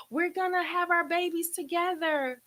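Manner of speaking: high-pitched squeal of good news; excited friend. Retold or imitated. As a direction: imitate a thrilled friend bursting to share. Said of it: "she was so excited".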